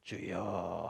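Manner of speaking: deep, soft drawn-out prayer sigh; mock-devout low 주여; low pious groan. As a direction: drop the voice low and breathe out a prayer. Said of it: "목소리를 아주 낮게 깔아서".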